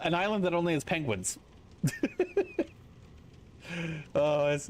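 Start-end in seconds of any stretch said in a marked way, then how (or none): 1.8-2.6 laugh. Real, not acted. Penguin tariff joke.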